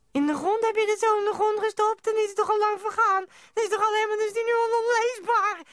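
very high, loud disbelieving shriek; outraged shrieking. Acted. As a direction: screech it out in alarm, without letting up. Outraged reaction to buried message.

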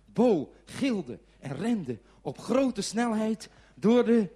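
loud, high, drawn-out words; dramatic telling. A narrator's reading. Storyteller as girlfriend races through customs, dramatic and loud. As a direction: build the chase slowly and loudly.

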